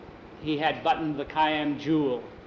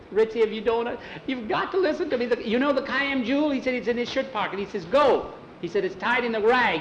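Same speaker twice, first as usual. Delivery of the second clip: high, fast, urgent voice; urging the dog; 7 semitones higher than usual. Acted. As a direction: plead with the dog to go.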